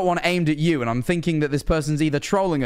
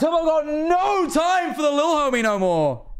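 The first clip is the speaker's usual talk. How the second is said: mock shout; 13 semitones higher, slower than usual. Mock lament at being ignored.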